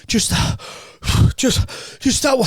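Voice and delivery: put-on old man, winded, pointing out his pump; out of breath. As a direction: gasp it out like he's about to collapse. Dying old man gasping directions.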